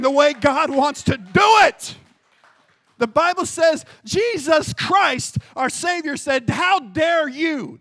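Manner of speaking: thundering pulpit admonition with drawn-out key words; forceful preaching. Acted. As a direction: build to a loud, high rebuke.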